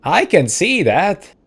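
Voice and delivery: high, loud, hammed-up irritation; indignant husband. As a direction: retort crossly in a comic character voice. Husband snaps back at wife.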